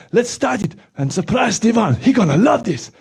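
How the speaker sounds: usual speaking voice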